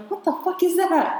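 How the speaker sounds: high, startled cry of alarm; startled yelp. Retold or imitated. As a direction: jump out of your skin mid-sentence. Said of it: "scared the shit out of me".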